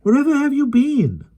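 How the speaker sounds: put-on high, bright child's call; high voiced girl, gaily. A narrator's reading. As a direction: Jane calling out happily. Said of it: "cried Jane gaily".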